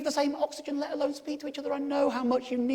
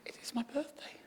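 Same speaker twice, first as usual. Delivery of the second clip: breathy unvoiced whisper; 12 dB quieter than usual. Telling reception it is his birthday, whispered. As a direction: whisper it down the phone. Retold phone call, whispered.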